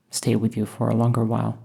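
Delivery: ordinary talk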